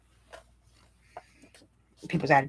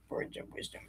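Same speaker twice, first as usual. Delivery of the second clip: whispered aside; 19 dB quieter than usual. Real, not acted. Mock quoting own words.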